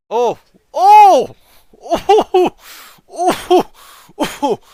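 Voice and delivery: loud high repeated cries; pained wincing shouts. Real, not acted. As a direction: wince out loud at each replay. Reacting to hit to groin.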